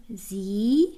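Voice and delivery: "زی" with a long, drawn-out ee; ie read as long i. Shown as a demonstration. Teacher showing learners how German ie sounds, long ee in "زی". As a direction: stretch the vowel of "زی" into one long ee.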